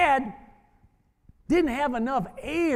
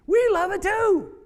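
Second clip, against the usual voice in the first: higher, louder, slow and heartfelt; 9 semitones higher, 4 dB louder than usual. Real, not acted. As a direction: tell her from the heart. Coach moved, reassuring the mother.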